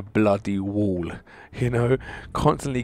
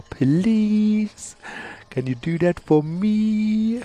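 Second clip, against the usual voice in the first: high, drawn-out begging whine; pleading; 11 semitones higher, much slower than usual. Real, not acted. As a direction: beg slowly in a high voice. Begging the game for four star.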